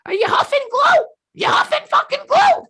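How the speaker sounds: very high, loud shrieking mom; mom shouting. Retold or imitated. Mom finding rubber cement, shrieking at her son. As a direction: scream it like a furious mother. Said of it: "she was like".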